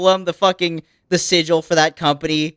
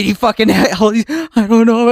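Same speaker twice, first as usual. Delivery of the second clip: normal talking voice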